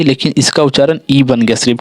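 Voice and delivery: normal talking voice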